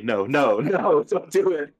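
lightly voiced big pleading giant; Andre the Giant. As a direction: beg like a lumbering giant, half laughing. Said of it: "I'm like, Andre the Giant".

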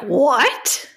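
high-pitched surprised "what?"; mock-stunned exclamation; retold astonishment. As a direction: act out your disbelief, pitch jumping up. Retells own surprise at seventeen countries.

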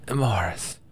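breathless whispered name; weak whisper. A narrator's reading. Dying Agala asking for Amaris, weak whisper. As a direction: barely get the name out on no breath. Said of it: "she managed".

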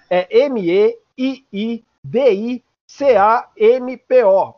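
slow, letter by letter; spelling out letters. Shown as a demonstration. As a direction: spell it out clearly for listeners. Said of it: "Então eu vou soletrar pra você".

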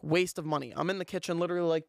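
ordinary talk, nothing marked

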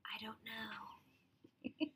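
slow breathy whisper, giggly. Real, not acted. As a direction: whisper it very slowly. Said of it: "Why are we whispering?".

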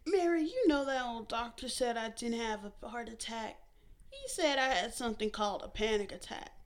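mother chiding her smart daughter; scolding mother. A narrator's reading. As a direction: lecture the daughter firmly. Fern telling doctor story.

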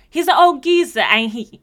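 loud, high mock British accent; brash put-on British accent; British slang accent. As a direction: imitate her teaching British slang, hammed up. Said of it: "some British slang".

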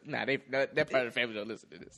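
normal talking voice

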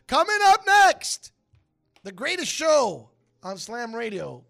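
loud, slow show announcer. Acted. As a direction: announce the next show with flair. Radio announcer intro.

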